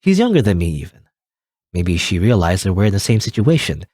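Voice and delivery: ordinary talk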